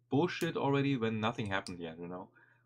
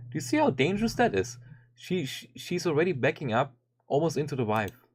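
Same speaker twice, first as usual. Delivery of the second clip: ordinary delivery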